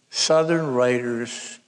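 Southern drawn out slow and leaned on; stress on Southern. Retold or imitated. Son echoing his mother's emphasis, Southern stressed slowly. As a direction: slowly lean hard on the word Southern. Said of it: "with great emphasis on the word Southern".

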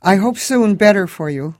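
high, loud Eastern European cabbie voice; thick Moldovan accent, chatty and friendly. A narrator's reading. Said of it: "This one had an accent".

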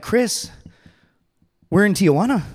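high-pitched, incredulous realization; alarmed disbelief. Acted. Handcuffed Marine to his buddy, alarmed disbelief. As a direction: confront your buddy in disbelief. Shocked at waking up in Tijuana.

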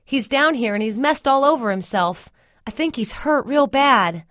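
high, urgent report; gasped alarm. A narrator's reading. Telling Cyril about injured Melvin, alarmed. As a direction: blurt out the bad news, pitched well up. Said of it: "I gasped".

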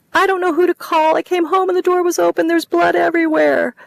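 high, loud, panicked sobbing cries; hysterical sobbing. Acted. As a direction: break down in hysterics on the phone. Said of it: "her hysterics".

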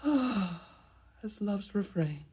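slow, soft sigh into the words. Acted. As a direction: breathe it out dreamily. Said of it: "drawing yet another sigh".

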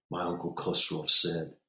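ordinary delivery

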